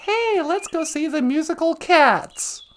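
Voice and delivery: usual speaking voice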